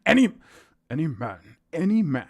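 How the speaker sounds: ordinary delivery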